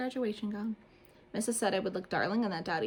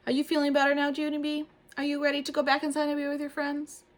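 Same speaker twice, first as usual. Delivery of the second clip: lightly higher, kind question; gentle teacher; 7 semitones higher than usual. A narrator's reading. Teacher comforting tearful Junie, gentle.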